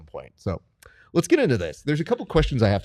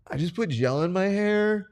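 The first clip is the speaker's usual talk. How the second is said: higher, mock whiny voice imitating the student; 9 semitones higher than usual. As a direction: ham up a vain excuse. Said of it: "And he goes".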